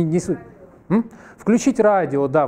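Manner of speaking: normal talking voice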